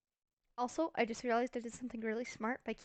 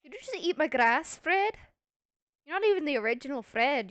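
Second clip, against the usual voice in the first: higher, louder telling-off; indignant scolding; 4 semitones higher, 8 dB louder than usual. Real, not acted. Player scolding Fred for eating the grass, indignant. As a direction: call out the culprit in playful outrage.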